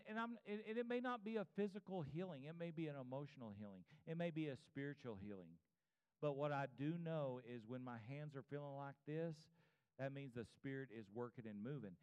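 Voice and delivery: ordinary talk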